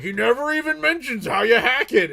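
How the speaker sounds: high, loud put-on whine; mocking complaint voice; mock commenter.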